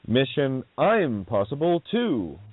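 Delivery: "Mission I'm Possible Two", drawn out with "I'm" as its own word; slow, deliberate wordplay on "I'm Possible"; re-split title. Shown as a demonstration. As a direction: slowly break "Impossible" into "I'm Possible". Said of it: "let's take it up a level".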